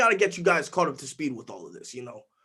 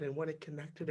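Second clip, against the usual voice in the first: ordinary delivery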